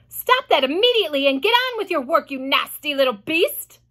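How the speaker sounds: mock angry aunt; harsh order. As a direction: bark orders at the boy. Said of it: "Aunt Sponge ordered".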